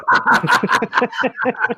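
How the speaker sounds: high, airless giggle; laughing at a friend on camera; breathy laugh. Real, not acted. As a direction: giggle under your breath. Laughing at Sam.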